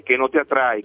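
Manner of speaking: ordinary talk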